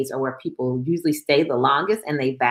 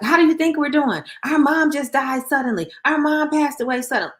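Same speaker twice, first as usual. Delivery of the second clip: indignant mock outburst, voice raised high; exasperated retort; 11 semitones higher, 5 dB louder than usual. Grieving young women answering well-meaning people's question, exasperated. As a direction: imitate upset daughters, pitched high and louder.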